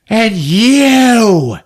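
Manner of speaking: high, long-drawn mock accusation; single someone out with a screech; hammed-up, stretched-out yell; accusing yell. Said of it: "she just yells to the crowd".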